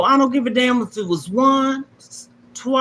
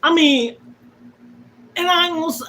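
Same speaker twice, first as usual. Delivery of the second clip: ordinary delivery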